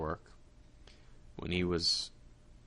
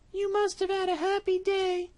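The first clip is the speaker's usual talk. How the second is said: a trusting mouse sending him off; little mouse; 6 dB louder than usual. Acted. Mouse greets cat.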